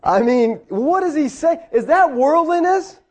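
high-pitched baffled questioning; incredulous. Acted. Teacher querying whether new gadgets are worldliness, incredulous. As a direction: question it in rising disbelief. Disbelief at quoted commentator.

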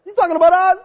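high, loud panicked question; alarmed crowd. Retold or imitated. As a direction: sound caught out and scared. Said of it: "and so they're like".

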